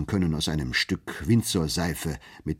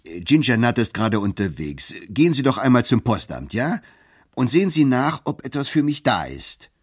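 louder, higher, pushing the errand; insistent order; 5 semitones higher, 6 dB louder than usual. Acted. Lawyer ordering Bartleby to the post office, louder and insistent. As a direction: press him hard with the order.

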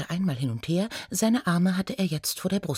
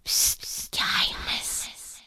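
hushed, slow stage whisper; secretive whisper; 3 dB quieter, slower than usual. Acted. Sharing today's secret with listeners, slow whisper.

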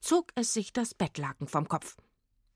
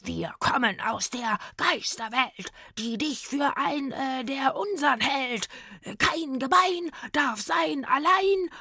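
ghostly rhyme; 7 semitones higher than usual. A narrator's reading. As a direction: intone the verse like a haunting spirit. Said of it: "dichtete er".

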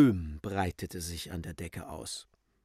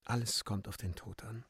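hushed, much softer aside; spoken softly; 9 dB quieter than usual. A narrator's reading. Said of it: "sprach leise zu Euphemia". (leise zu ihr gesprochen)

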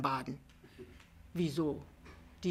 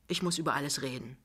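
ordinary delivery

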